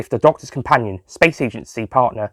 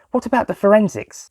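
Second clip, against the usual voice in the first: Lisa's voice; 10 semitones higher than usual. A narrator's reading. Lisa asks the inspector.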